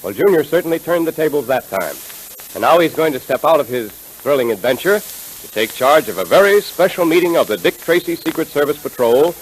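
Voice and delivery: ordinary talk, nothing marked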